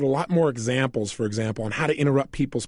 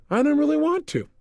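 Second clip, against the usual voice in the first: mock confession pitched much higher; sheepish admission; 10 semitones higher than usual. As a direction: imitate the patient blurting it out, higher. Patient admits he doesn't want change.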